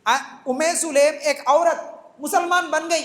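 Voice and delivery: ordinary talk, nothing marked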